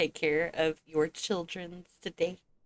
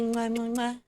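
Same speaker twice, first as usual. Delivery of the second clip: kiss sounds; 5 semitones higher than usual. Real, not acted. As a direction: blow kisses at chat. Blowing kisses to chat.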